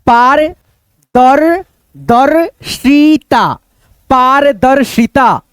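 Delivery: पारदर्शिता in slow, separate chunks, पार-दर-शिता; syllable-by-syllable reading. Shown as a demonstration. Teacher pointing at the word for students, पारदर्शिता broken into slow syllables. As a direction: spell out पारदर्शिता by sound, then join it slowly. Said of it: "अब यहाँ पे देखो".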